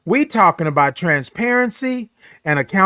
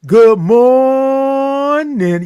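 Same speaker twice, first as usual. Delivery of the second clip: loud, high sung-out greeting; drawn-out morning greeting; 7 semitones higher, 5 dB louder, much slower than usual. Real, not acted. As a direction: hold the O of morning very long. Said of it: "I wanna say this to you all".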